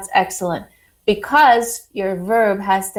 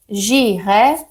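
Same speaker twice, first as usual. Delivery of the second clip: careful French accent on j'irai au supermarché; slower than usual. Shown as a demonstration. Teacher modelling a French accent for the class. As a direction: lean into the French accent, slowly. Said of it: "get my accent in there".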